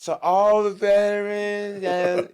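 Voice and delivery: high, slow, put-on voice of a drunk heckler; mocking drunk woman. Drunk woman interrupting his set, imitated high and slow. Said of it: "and she goes".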